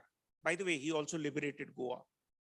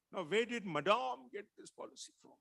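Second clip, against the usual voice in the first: mocking Bengali accent; 7 semitones higher, 5 dB louder than usual. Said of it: "Rajiv looked at him and mimicked him".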